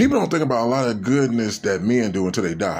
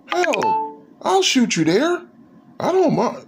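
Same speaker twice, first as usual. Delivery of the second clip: much higher, slow, easygoing neighbor voice; generous neighbor; slower than usual. Retold or imitated. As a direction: offer the ride like a cheerful high-voiced neighbor. Neighbor offers a ride.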